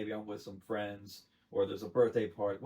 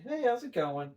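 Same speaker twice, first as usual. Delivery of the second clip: high, loud, chirpy hello; acted friendly greeting; 12 semitones higher, 6 dB louder than usual. Bartender greeting someone out in public, acted chirpy. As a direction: greet them in an upbeat service voice.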